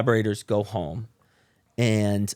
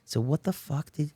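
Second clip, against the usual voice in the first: ordinary talk, nothing marked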